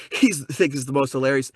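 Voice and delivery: ordinary talk, nothing marked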